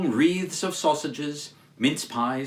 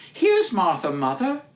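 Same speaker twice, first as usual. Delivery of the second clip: put-on higher, bright girl's voice; cheerful girl; 4 semitones higher, 4 dB louder than usual. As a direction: announce yourself cheerily. Martha arrives.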